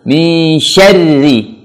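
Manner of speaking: slow chanted "Mi syarri", R held thin; thin, drawn-out syarri. Shown as a demonstration. Teacher modelling recitation for learners, thin and drawn-out "Mi syarri". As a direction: stretch "Mi syarri" slowly and keep SYARRI thin. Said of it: "Langsung tarik tipis".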